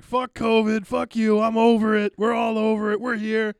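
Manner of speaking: loud, high imitation of a comic's rant; defiant mock rant. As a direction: belt it out like a fed-up comedian. Retelling comedian's defiant rant on stage.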